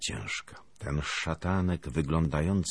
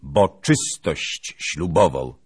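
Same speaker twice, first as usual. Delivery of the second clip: slow, loud, each word driven home; loud and emphatic; 9 dB louder, slower than usual. A narrator's reading. Lieutenant leans to her ear, yet loud and emphatic. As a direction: announce it loudly, word by word. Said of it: "rzekł bardzo głośno i dobitnie".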